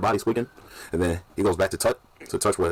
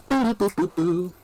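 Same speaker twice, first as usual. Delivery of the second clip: quick run of high-pitched boops; imitated beeping; 12 semitones higher than usual. Retelling a funny movie scene, high mock beeping. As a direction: sound out little high beeps.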